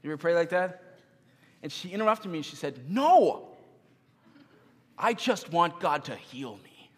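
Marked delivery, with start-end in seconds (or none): 2.9-6.9 put-on a little girl cutting off her father's prayer; daughter's voice. Daughter interrupts his prayer.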